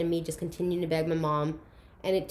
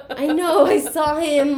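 high, loud mock wail; 7 semitones higher, 10 dB louder, slower than usual. Telling mom she saw dad already, loud mock wail. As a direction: wail it like a panicked teen. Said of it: "And I'm like".

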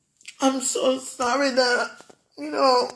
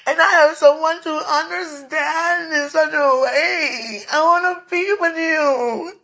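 real tearful plea, higher and louder; crying; 5 semitones higher, 4 dB louder than usual. Real, not acted. Speaker longs to be with the one they pray to, crying. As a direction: cry out with longing, voice raised. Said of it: "I'm crying while you're listening".

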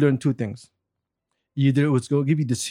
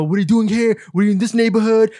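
higher-pitched imitation of cops hassling; retold police harassment; mock hassling cops; 6 semitones higher, 3 dB louder than usual. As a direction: throw questions in their face, mockingly. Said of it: "they started talking shit in our face".

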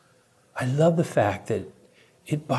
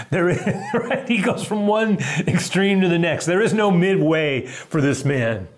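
ordinary talk

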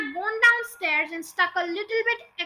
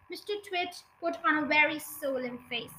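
ordinary delivery

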